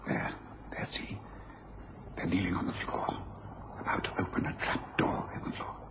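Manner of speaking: hushed whisper while hiding; tense stakeout whisper. Acted. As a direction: whisper so the men can't hear. Hiding, watching the criminals.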